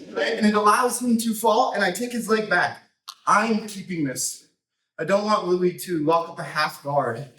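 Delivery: normal talking voice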